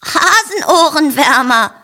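high, bleated word; bleating sheep. A narrator's reading. Charlotte the sheep answering Rosa, high bleating. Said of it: "blökt Charlotte".

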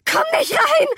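very high, squealed warning; panicked cry. Acted. May keeps her mother out of the bathroom, panicked cry. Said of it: "schrie May panisch".

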